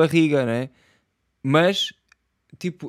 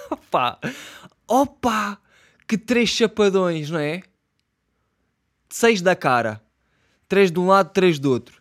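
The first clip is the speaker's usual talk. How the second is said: loud exasperation; 4 semitones higher, 6 dB louder, slower than usual. Real, not acted. Wants to slap him.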